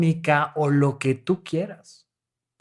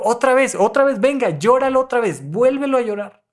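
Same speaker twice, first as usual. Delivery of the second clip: urging therapist; 7 semitones higher, faster than usual. Retold or imitated. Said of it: "y les digo".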